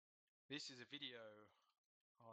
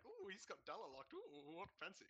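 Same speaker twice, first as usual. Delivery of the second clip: higher-pitched mock admiration; impressed onlooker; 7 semitones higher than usual. Gamer teasing friend as an impressed viewer, mock awe. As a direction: coo in exaggerated, teasing awe. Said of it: "so you can be like".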